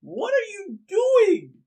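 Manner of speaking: very high, loud and drawn-out; scandalized high-pitched yell; outraged shriek. Acted. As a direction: shriek it at the intruder. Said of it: "walk outside and they go".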